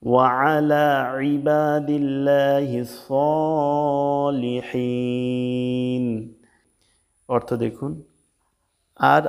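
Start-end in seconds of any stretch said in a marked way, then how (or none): 0.0-6.0 vowels stretched long, chanted Arabic; slow recitation demo. Shown as a demonstration. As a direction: recite slowly for students to follow. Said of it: "আমরা সর্বশেষ আর একবার বলি আসুন".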